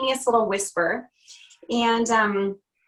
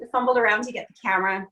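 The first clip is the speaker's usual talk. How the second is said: usual speaking voice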